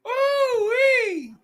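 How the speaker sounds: high-pitched shivering whoop; a high cold whoop. Real, not acted.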